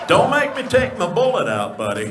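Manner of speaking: loud, puffed-up threat, lightly voiced; a hint of the jumpy deputy bluster; Barney Fife voice. Barney warns a heckler, loud mock threat. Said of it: "Barney Fife".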